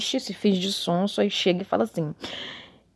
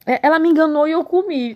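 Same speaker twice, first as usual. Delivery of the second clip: mocking Eve; 8 semitones higher, 5 dB louder than usual. Mocking Eve's excuse.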